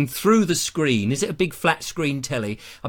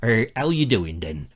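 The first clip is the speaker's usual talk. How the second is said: slow West Country burr; West Country accent; 3 semitones lower than usual. Shown as a demonstration. Host doing West Country instead of Australian, broad rustic accent. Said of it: "I'll do one from, uh, the West Country".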